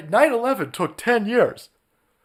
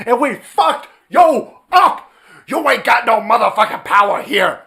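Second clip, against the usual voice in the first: triumphant yell; 3 semitones higher, 4 dB louder than usual. Real, not acted. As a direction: rub it in their faces, loud. Triumphant rant at opponents.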